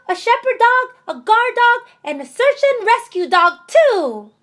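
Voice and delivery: put-on high, eager little girl listing proudly; excited child voice. Little girl boasting to Mama about her dog, high and eager.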